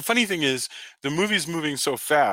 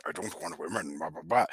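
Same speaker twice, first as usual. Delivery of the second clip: lower, much softer mock grumble; mock gruff chauvinist; 7 semitones lower, 8 dB quieter than usual. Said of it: "his lines about".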